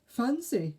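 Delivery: very high, prancing fairy. Acted. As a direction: squeak it out like a fairy. Mavis fairy voice.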